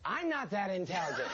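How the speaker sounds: high, airy showman aside; lightly voiced magician. Still as the magician, a high airy aside. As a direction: stay in the wide-eyed magician voice.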